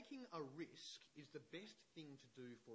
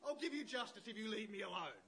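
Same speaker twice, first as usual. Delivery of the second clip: high, loud fed-up outburst that gives in; exasperated judge; 11 semitones higher, 15 dB louder than usual. Retold or imitated. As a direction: shout it out, fed up and giving in. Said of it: "gets so annoyed that he goes".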